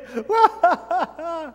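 high-pitched fake sobbing wail; hammed-up crying in bursts; mock wailing. Preacher imitating the weeping daughters-in-law, mock wail. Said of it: "they wept again".

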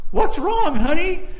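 loud, high-pitched concerned husband; worried husband. Retold or imitated. Husband to his shaken wife, loud worry.

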